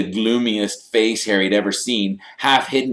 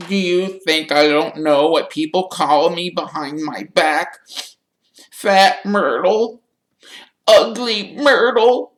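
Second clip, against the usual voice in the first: wailing that climbs higher and louder; anguished Myrtle; 6 dB louder than usual. A narrator's reading. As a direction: list each insult slowly, building to a shrieking moan. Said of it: "burst into anguished sobs".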